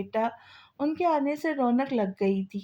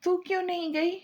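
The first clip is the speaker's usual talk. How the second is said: surprised; 8 semitones higher than usual. Acted. Friend surprised she stayed home.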